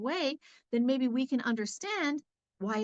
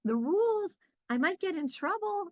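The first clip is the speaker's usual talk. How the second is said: put-on timid child; 6 semitones higher than usual. Timid child telling the parent, scared high voice. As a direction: plead like a nervous kid, pitched up. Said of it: "a timid child who's like".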